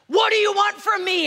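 high, loud confrontational scream. Acted. Man in a restaurant turning on Norm, screaming. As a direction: scream it at him like a challenge. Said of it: "turns his attention towards Norm and screams".

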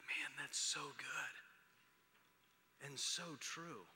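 soft, slow, barely voiced whisper; moved whisper. Real, not acted. Pastor moved by his son's words, whispering. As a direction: whisper it, choked up. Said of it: "I'm like".

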